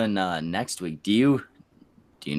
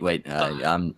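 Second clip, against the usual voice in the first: ordinary talk, nothing marked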